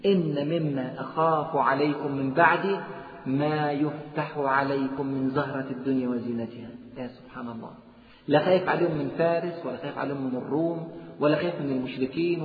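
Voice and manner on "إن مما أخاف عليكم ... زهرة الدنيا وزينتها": slow solemn recitation. Acted. Hadith slow.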